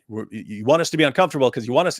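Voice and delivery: ordinary talk, nothing marked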